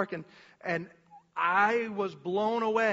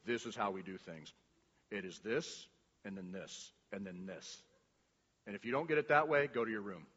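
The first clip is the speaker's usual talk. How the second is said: much lower and softer, a flat stern rule-giver; stern rigid god; 11 semitones lower, 10 dB quieter than usual. Retold or imitated. Preacher mocking a rigid God who sends people to their room. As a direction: lay down the rules coldly, then send them to their room. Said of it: "Jesus was just like".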